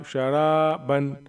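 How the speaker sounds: "शराबन" slowly, with रा drawn out; short शा, held रा, short बन in "शराबन"; long रा. Shown as a demonstration. Teacher showing which letter stretches, long रा in "शराबन". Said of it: "रा को तो खींचेंगे".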